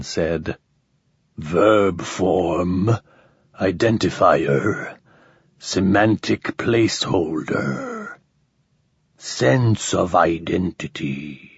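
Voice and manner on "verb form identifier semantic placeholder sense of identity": low, slow machine-like voice; rusty metallic alien. Acted. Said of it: "disturbingly rusty and metallic".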